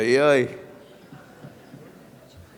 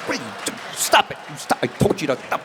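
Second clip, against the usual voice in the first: mother scolding herself; 3 semitones lower, 5 dB quieter, faster than usual. Retold or imitated. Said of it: "She's like".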